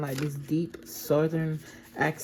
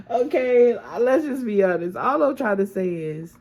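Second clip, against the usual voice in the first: amused and hyped; 6 semitones higher, 8 dB louder than usual. Real, not acted. Still laughing, hyped after joke.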